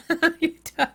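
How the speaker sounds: a real, high chuckle; amused laughter; short high laugh. Real, not acted. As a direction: chuckle it away. Laughs again.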